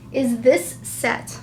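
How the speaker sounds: normal talking voice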